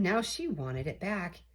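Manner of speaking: plain reading voice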